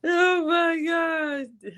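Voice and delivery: drawn-out, high shocked cry; shocked oh my gosh. Real, not acted. Laughing friend hears the nickname Lil Tyson, drawn-out shocked cry. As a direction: gasp it out slowly in disbelief.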